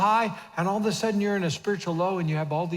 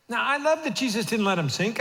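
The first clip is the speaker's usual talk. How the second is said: usual speaking voice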